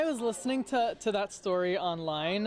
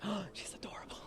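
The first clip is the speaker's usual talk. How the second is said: whisper; 12 dB quieter, faster than usual. Retold or imitated. Gasping at the grandma.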